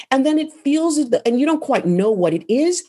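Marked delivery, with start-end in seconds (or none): none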